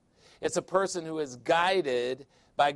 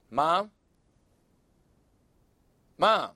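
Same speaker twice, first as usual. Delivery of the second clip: louder, insistent calling into the phone; 3 semitones higher, 4 dB louder than usual. Retold or imitated. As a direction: call her name twice, raising it. Calling mom on silent phone line.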